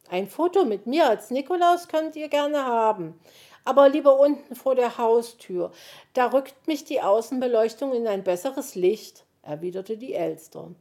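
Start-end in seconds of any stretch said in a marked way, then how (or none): 0.1-9.3 higher, louder self-satisfied bird voice; vain magpie, gracious. A narrator's reading. Magpie agrees to pose for a photo, preening. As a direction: play a vain magpie granting a favour.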